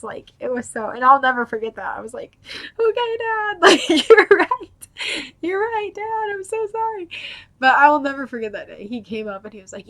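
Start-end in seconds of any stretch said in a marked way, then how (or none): 2.8-7.1 high, loud wail of giving in; mock-tearful apology. Daughter apologising to her dad, wailing. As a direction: reenact a chastened daughter's squeaky apology.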